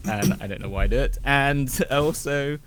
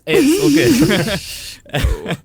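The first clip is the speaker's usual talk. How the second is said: sudden loud outburst; 10 dB louder than usual. Acted. Vampire hints at menace.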